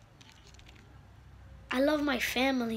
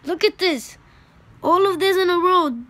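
viewers' reaction; 7 semitones higher, 12 dB louder than usual. Retold or imitated. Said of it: "They might be saying".

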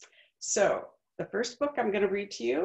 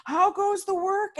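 farmer calling; 13 semitones higher, 5 dB louder than usual. Acted. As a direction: call out lazily from bed. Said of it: "he called".

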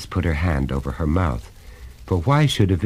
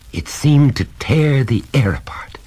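hushed whisper; 7 semitones higher, 4 dB louder than usual. A narrator's reading. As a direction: breathe it out in dread. Narrator whispers the horror.

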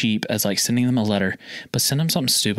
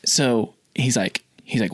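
usual speaking voice

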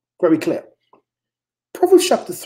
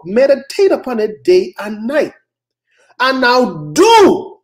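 loud, high-pitched sermon delivery; forceful preaching; 6 semitones higher, 5 dB louder than usual. Real, not acted. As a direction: drive each point home to the congregation.

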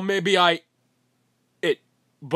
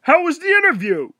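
loud mocking greeting; 10 dB louder than usual.

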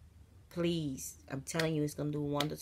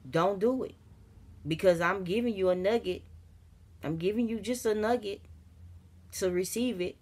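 1 fervent preaching; 4 semitones higher, 4 dB louder, faster than usual. Real, not acted. Preaching urgently to viewers.

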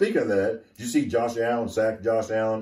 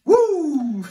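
high loud whoop; mock cheer; 7 dB louder than usual. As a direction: whoop it up sarcastically. Cheering the Dolphins sarcastically.

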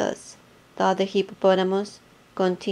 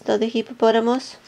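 ordinary delivery

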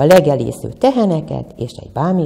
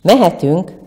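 mildly raised cheerful call; 4 semitones higher, 4 dB louder than usual. A narrator's reading. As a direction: sing out that it's time to go. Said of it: "s felkiáltott". (vidám felkiáltás)

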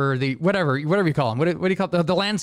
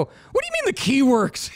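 high, incredulous question; asking in disbelief, pitch up; disbelieving; 8 semitones higher than usual. Retold or imitated. Retelling his buddy starting his car, incredulous. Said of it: "And I go".

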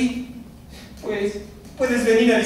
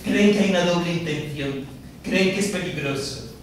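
normal talking voice

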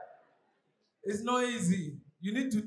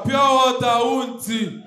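hawker call; 7 dB louder, slower than usual. Retold or imitated. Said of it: "they'll come to your car".

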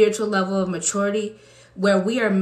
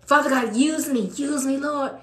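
higher pleading prayer that cracks into a cry; desperate prayer; 5 semitones higher than usual. Retold or imitated. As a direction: beg God to use you, near tears. Praying as someone desperate.

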